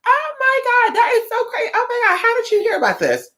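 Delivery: very high gushing voice of an overjoyed shopkeeper; excited shop lady. Retold or imitated. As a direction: squeal it like a thrilled old lady. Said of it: "she was like".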